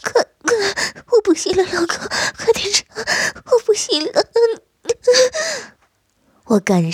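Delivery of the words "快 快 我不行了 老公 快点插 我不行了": the wife losing all control, crying out; much higher, urgent cries. Acted. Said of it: "放声尖叫".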